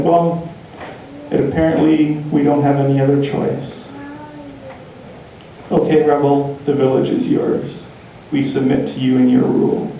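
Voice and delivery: usual speaking voice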